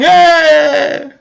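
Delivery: high, sustained mock scream; mock outraged scream. Host mimicking Howard's outrage at corruption, mock screaming. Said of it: "you'd be going".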